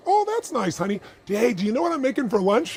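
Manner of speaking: very high, airy, chirpy brush-off; breezy indifference. Retold or imitated. Wife brushing off the bonus news, very high and airy. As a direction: sound unbothered and change the subject. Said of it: "And she goes".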